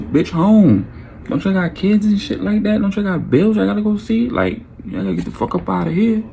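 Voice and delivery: high-pitched retold telling-off; exasperated dismissal. Man kicks lingering guests out of his crib, exasperated. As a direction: shoo them out, fed up.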